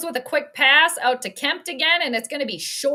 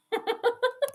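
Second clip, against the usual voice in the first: high, soft giggle; 11 semitones higher, 5 dB quieter than usual. Real, not acted. Fan giggling at the failed try, high. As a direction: let a small high laugh out. Laughing at the play.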